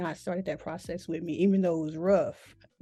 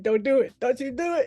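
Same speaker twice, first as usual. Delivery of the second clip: self-warning; 6 semitones higher, 4 dB louder than usual. Retold or imitated. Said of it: "I was like".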